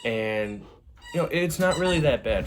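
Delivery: normal talking voice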